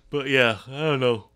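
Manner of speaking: ordinary talk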